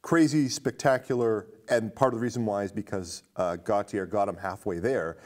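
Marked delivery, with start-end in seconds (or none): none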